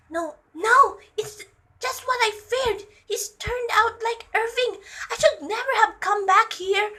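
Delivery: high-pitched dismayed lament; distraught mother. A narrator's reading. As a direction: voice a mother's fears coming true, high. Distraught mother voiced in story.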